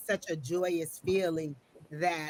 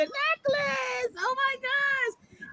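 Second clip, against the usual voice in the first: much higher, slow, delighted gush; real delight, drawn out and much higher; excited squeal; 5 dB louder, much slower than usual. Real, not acted. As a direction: let the surprise lift the voice way up. Reacting to confirmed necklace reveal.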